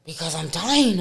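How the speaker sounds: mock desperate cry to mom; panicked plea. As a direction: beg for help as if dying. Retold panic calling mom.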